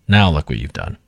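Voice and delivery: ordinary delivery, nothing marked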